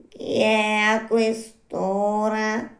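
high, drawn-out little snail voice; slow snail. Acted. Snail calling down to a night caller, slow snail voice. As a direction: stretch each word like an unhurried snail.

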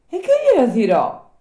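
high, loud little puppet voice; eager high-pitched questioning; inquiring puppet. Acted. Puppet questions old man.

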